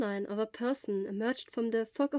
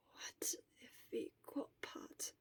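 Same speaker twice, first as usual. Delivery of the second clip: hushed question; 2 semitones higher, 16 dB quieter than usual. Acted. Hisashi suddenly hushed.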